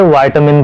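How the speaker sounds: "विटामिन" as VAI-tuh-min; "eye" vowel opening "विटामिन"; American pronunciation. Shown as a demonstration. Teacher allows another form, "विटामिन" as vai-tuh-min. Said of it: "वो भी सही है".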